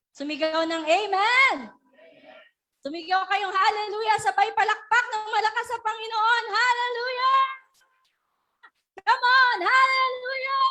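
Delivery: high, loud rallying hallelujah calls; preaching shout. Real, not acted. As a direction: fire up the crowd at full voice. Pastor whips up congregation shouting.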